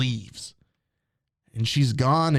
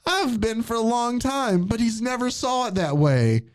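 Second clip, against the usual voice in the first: mock tearful melodrama; 9 semitones higher than usual. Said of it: "She goes".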